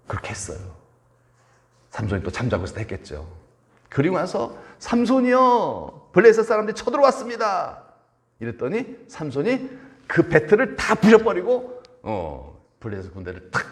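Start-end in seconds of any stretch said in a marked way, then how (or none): 4.8-7.8 shouted signal. Acted. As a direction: call out the invasion alarm. Acting Delilah's alarm signal.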